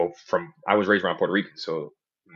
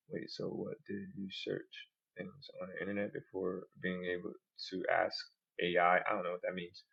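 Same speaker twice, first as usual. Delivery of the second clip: soft AI voice; 10 dB quieter than usual. Real, not acted. AI voice reading replies.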